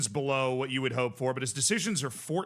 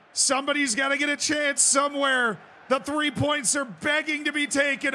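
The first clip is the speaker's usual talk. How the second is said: high, loud yells urging the striker on; cheering shout; 11 semitones higher, 6 dB louder than usual. Real, not acted. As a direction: scream encouragement at the player.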